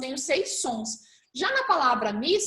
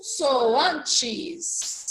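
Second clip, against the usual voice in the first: "consoantes" stretched slowly, syllable by syllable; "consoantes" said long and deliberate for a class; drawn-out key word. Shown as a demonstration. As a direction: draw out each syllable of "consoantes". Teacher stretches the key term.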